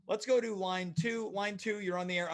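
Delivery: ordinary delivery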